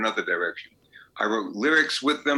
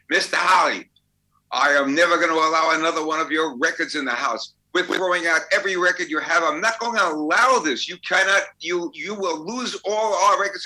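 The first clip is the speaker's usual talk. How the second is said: higher and louder, an outraged mother's tirade; angry mother yelling; 6 semitones higher, 3 dB louder than usual. Retold or imitated. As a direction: retell her furious outburst at the table. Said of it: "she now starts screaming".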